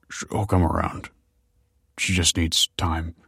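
worn, choked-up croak. A narrator's reading. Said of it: "croaked the father".

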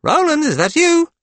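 high, loud call to a tiny creature; exaggerated high-pitched greeting; playful pretend surprise. A narrator's reading. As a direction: act amazed for a child's benefit. Father pretending to address ant.

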